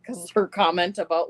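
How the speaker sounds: normal talking voice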